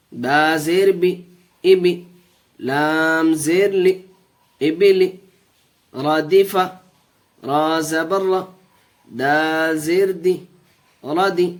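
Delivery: steady, measured recitation of each syllable; spelling drill. Shown as a demonstration. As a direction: lead the class through the spelling. Teacher drills syllable joining.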